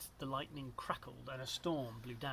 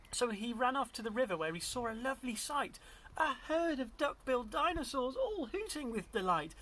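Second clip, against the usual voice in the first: high, lilting storybook narration; story read to a child; 8 semitones higher than usual. A narrator's reading. Reader tells child of Drip's discovery, high animated narration. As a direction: build delight at the lovely sight. Reads picture book to child.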